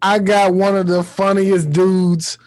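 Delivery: ordinary talk, nothing marked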